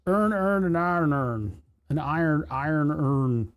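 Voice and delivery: "Aaron earned" slurred into "Earn, earn"; playful slurred tongue-twister on "iron urn"; merged vowels. Shown as a demonstration. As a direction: run the words together as drawn-out "urn" sounds. Plays with merged vowel sounds.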